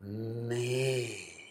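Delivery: long, humming "me" felt in the chest; resonant me. Shown as a demonstration. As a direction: let "me" vibrate in the chest. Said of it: "Breathe and go".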